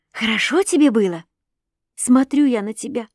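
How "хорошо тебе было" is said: ordinary delivery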